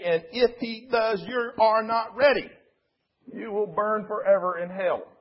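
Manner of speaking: high, forceful mock fire-and-brimstone sermon; imitation of a condemning revival preacher; hellfire preacher. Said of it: "The sermon went like this".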